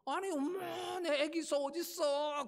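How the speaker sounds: high, slow wailing cow mother; anguished mother cow calling; mother cow crying. Acted. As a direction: cry out for the lost calf, high and drawn out. Preacher voices mother cow crying.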